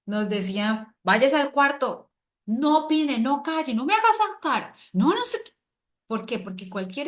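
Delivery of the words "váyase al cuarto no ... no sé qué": high, loud mock scolding of a child; an annoyed mother sending her child to its room; scolding parent. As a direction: bark orders at the kid like an angry parent. Parents scolding child, acted quote.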